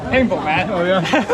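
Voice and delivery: ordinary delivery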